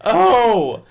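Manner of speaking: high, loud anguished Oh; moan of dread. Acted. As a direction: moan in horror. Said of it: "Evan moaned".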